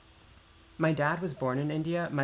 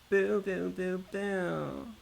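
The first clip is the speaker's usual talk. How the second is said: high, drawn-out "boo" sounds; playful boo-boo noises; 5 semitones higher, much slower than usual. Pretend. Streamer filling time after reading dialogue, playful high booing. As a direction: toot out sustained high boos, fading at the end.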